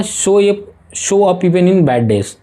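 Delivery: drawn-out, deliberate English line; slow emphatic motto. Shown as a demonstration. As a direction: stretch it out like a slogan. Repeating the point's motto. (धीमे, नारे की तरह खींचकर)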